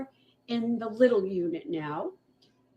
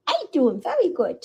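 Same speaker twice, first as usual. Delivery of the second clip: much higher, louder cutesy kitten voice; voicing the kitten; 7 semitones higher, 3 dB louder than usual. Rescuer speaks for the kitten to viewers, high cutesy voice. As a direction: speak for the kitten in a tiny happy voice. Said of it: "Tell everybody".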